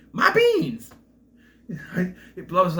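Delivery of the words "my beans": high, loud squealing "My beans!"; mock Jorgen yell. Imitating Jorgen to a viewer, a high loud squeal. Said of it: "One more time".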